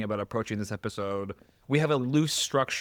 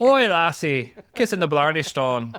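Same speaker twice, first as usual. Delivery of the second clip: put-on Irish accent; 4 semitones higher, 7 dB louder than usual. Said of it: "Will there be bad Irish accents?".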